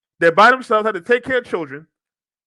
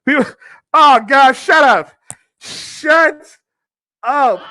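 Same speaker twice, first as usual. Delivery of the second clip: loud, higher-pitched, laughing disbelief; exasperated outburst; 4 semitones higher, 5 dB louder, much slower than usual. Real, not acted. Host snaps at the article's sympathy, exasperated outburst. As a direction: shout it down in real exasperation. Exasperated reaction to quote just read.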